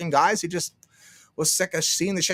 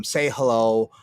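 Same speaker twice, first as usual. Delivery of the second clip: ordinary talk, nothing marked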